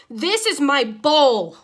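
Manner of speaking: a fed-up fish screaming at his tankmates. Acted. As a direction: scream out that the bowl is yours. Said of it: "I screamed".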